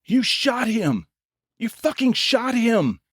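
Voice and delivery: high, slow furious scream; screaming in rage. A narrator's reading. Dylan screams at Jack after the shooting, enraged. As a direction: scream it at the killer. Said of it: "he screamed, his voice full of rage".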